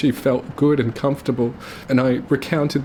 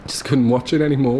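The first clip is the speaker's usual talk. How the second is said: ordinary talk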